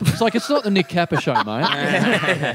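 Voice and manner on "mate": ordinary delivery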